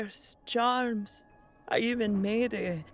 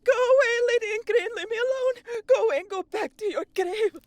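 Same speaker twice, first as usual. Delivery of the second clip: high, loud, fearful pleading; trembling high cries of terror; terrified; 8 dB louder, faster than usual. Acted. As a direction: shriek at the ghost to leave. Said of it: "shaking in fear".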